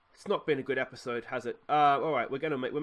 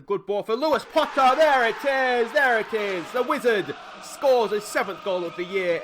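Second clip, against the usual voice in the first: higher, louder, celebrating the goal; excited goal call; 8 semitones higher, 9 dB louder than usual. Real, not acted. Gamer celebrating his team's goal, thrilled shout. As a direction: yell it out as the ball goes in.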